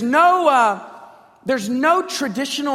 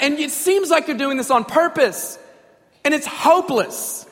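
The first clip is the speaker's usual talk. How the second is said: raised, high-pitched outburst at God; irreverent confrontation; 4 semitones higher, 4 dB louder than usual. Acted. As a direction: confront God loudly, with no reverence. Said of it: "there's no reverence, just, just".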